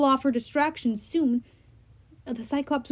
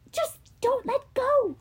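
Annabeth voice; 13 semitones higher, slower than usual. Acted.

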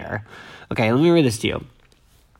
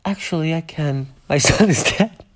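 Mary Louise deadpan; 4 semitones higher, 3 dB quieter than usual. Retold or imitated. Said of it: "Mary Louise says".

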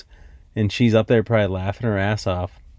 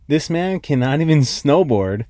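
ordinary delivery

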